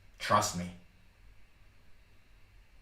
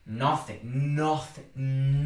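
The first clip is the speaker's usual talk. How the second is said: ordinary talk, nothing marked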